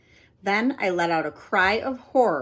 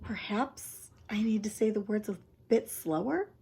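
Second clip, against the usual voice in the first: quiet, softer wondering; hushed; 7 dB quieter than usual. Acted. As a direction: keep it hushed and puzzled. Continues whispered thought.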